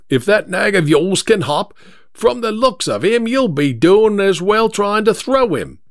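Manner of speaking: high-pitched, taunting London driver; mock the other driver in a cabbie's voice; rival cabbie. Second driver insults the first one's horse, taunting.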